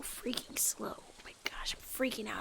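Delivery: panicked breathy whisper. Real, not acted. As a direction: whisper in a nervous rush. Rushing to catch the train.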